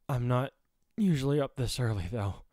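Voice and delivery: plain reading voice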